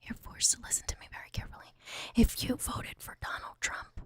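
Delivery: fast mock ASMR whisper. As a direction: whisper insults sweetly like an ASMR video. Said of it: "I haven't done A S M R".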